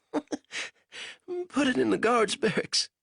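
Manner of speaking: slow, giggly prisoner's voice; giggling at his own prank. A narrator's reading. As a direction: giggle the words out slowly. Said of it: "Banks giggled".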